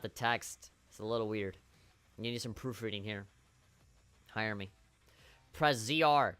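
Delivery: usual speaking voice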